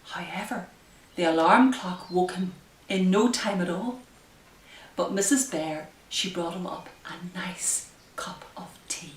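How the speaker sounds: usual speaking voice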